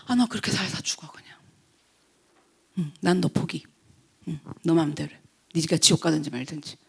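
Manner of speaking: low, hushed giving-up; resigned dismissal. Acted. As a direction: write them off quietly, under your breath. Acts giving up on someone. (낮고 작게 체념하며 내뱉듯)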